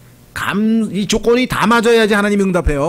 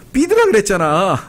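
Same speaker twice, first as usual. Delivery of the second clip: mock rebuke; 2 semitones higher than usual. God's rebuke acted out.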